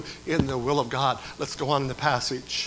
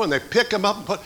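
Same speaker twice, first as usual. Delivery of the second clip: usual speaking voice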